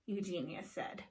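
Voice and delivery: ordinary delivery, nothing marked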